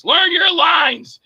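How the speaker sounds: high, slow, drawn-out yell; retold yell. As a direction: imitate a fed-up director hollering an order. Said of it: "I'm sorry to yell at you".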